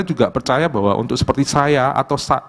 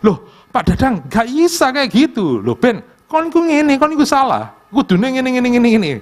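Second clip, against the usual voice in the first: higher, louder retold row with his father; raised voice arguing in Javanese; heated argument; 10 semitones higher, 3 dB louder than usual. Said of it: "Kenceng-kencengan suaranya".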